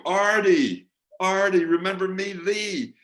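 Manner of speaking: high, loud eager call across the stage door; excited calling out. Retold or imitated. Fan calling to [name] at the stage door, high excited shout. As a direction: shout his name like an old friend, hoping he remembers.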